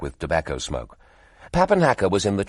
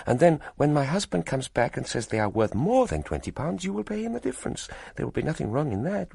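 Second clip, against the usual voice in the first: a hint of a coaxing foreign wife, higher; lightly voiced foreign wife; 7 semitones higher, 3 dB quieter than usual. Wife coaxing William to buy the stones, lightly higher. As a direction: gently wheedle the money out of him.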